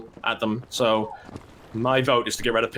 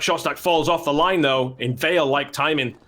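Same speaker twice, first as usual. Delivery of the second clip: ordinary delivery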